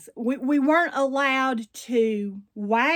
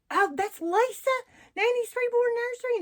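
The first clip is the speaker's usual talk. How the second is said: imitated delighted recognition; 12 semitones higher than usual. Vlogger imitates people recognizing the voice, high and surprised. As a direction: mimic fans suddenly recognizing someone, pitched way up.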